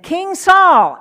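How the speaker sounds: calling out. Acted. David calls out from cave entrance.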